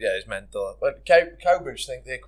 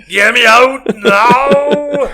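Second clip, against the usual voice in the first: drawn-out, high, loud mock wail; panicked scream; 11 semitones higher, 12 dB louder, much slower than usual. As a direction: howl it like you're trapped. Acts man stuck in swing.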